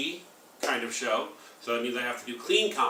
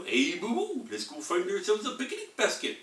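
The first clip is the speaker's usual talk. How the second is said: Yogi Bear; 8 semitones higher than usual. Retold or imitated. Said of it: "remember Yogi Bear?".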